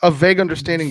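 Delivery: words through laughter; laughing while talking. Real, not acted. Player laughs while answering.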